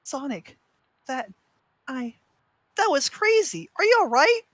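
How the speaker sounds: put-on high, loud young fox; Tails voice. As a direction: stammer then burst out high. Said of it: "He struggled to speak at first".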